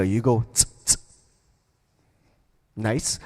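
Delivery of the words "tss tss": short tight tss tss; tighter hi-hat. Shown as a demonstration. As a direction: clamp the hiss shorter. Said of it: "Now you go tighter".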